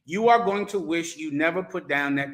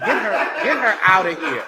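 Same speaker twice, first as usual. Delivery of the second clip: mock order; 5 dB louder than usual. Mock order to remove her.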